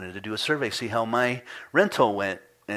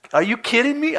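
incredulous; 9 dB louder than usual. Retold or imitated. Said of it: "I'm like".

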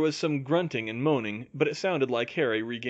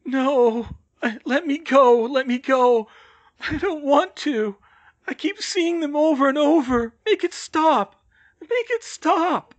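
high, loud, distressed begging; anguished pleading; 13 semitones higher, 8 dB louder than usual. A narrator's reading. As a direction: beg to be released, drawing out the last pleas. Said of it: "Bill moaned long and low".

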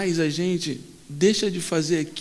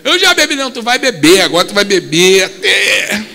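loud banter; 8 dB louder than usual. Acted. Jorge and Moses banter.

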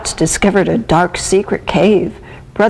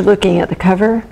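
usual speaking voice

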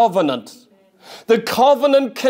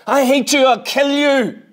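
angry Cain; 4 semitones higher than usual. Acted. Cain voiced hating Abel.

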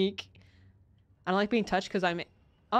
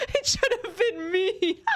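mock wailing; 10 semitones higher than usual. Mock dramatic wail over goblin.

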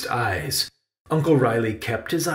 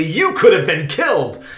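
higher, louder alarmed scolding; exclaiming; 5 semitones higher, 6 dB louder than usual. A narrator's reading. Uncle realizes Benny's danger, loud alarmed exclamation. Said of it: "exclaimed Uncle Riley".

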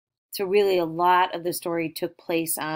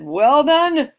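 loud, higher troll outburst; 7 semitones higher, 11 dB louder than usual. Acted. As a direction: burst out loudly as the grumpy troll. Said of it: "And then he said".

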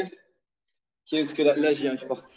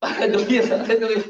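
laughing; 5 semitones higher, 4 dB louder than usual. Real, not acted. Laughing himself while lifting.

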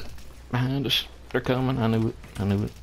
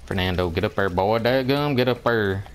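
ordinary talk

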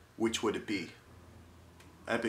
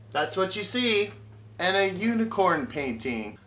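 lab guy voice; 7 semitones higher, 4 dB louder than usual. Retold or imitated. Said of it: "some guy in a lab like".

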